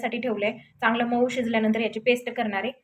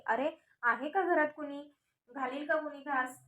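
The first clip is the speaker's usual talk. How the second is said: raised, lightly acted beggar's call; 4 semitones higher, 6 dB quieter than usual. A narrator's reading. Old woman begging at the palace door, calling out. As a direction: call into the house for alms, mildly. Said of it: "तिनं आरोळी दिली".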